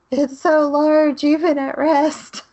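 slow, high, mock-dazzled gasp of a line; mock awed heroine. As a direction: ham up a swooning, awed woman.

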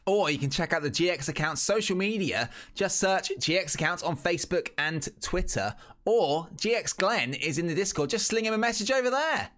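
ad read. Acted. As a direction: pitch the sponsor with gusto. Sponsor read.

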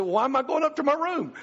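high, wide-eyed child voice, playing innocent; a little girl feigning surprise; innocent daughter.

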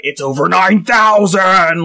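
iconic anime battle-cry imitation; mock shout, swelling on the drawn-out "thousand"; Vegeta. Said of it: "which is why the iconic, like".

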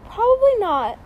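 real crack, pitch jumps up; voice cracking up an octave. Real, not acted. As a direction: voice suddenly breaks high. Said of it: "my voice just cracked".

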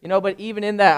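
ordinary delivery

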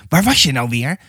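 plain reading voice

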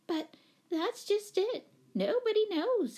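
very high, fluttery voice; fussy lady explaining. A narrator's reading. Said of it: "explained Miss Giddings".

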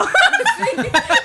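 squealing high laugh, real; high burst of laughter. Real, not acted. Friends recalling the honest donkey, real squeal of laughter. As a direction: laugh out loud, high and bright.

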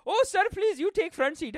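high, eager mock Indian accent; deferential Indian volunteer. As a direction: put on a pleading Indian-accented politeness. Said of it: "in India, they just volunteer".

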